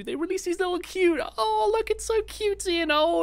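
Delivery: high, gushing mock-cute voice; mocking cutesy voice. Mocking Valve's cute sale events in a high gushing coo. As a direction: coo over the adorable event sarcastically.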